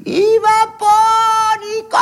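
a lightly sung booming call; voice from above. Retold or imitated. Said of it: "une voix de là-haut".

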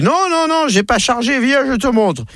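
insisting farmer. Acted. Farmer Lucien insists to Father Christmas, eager. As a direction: insist he's not drunk.

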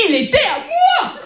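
high, slow whining complaint; whiny high protest. Acted. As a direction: wail it like a cheated kid. Se plaint d'avoir perdu un rôle. (plainte aiguë et traînée, un peu pleurnicharde)

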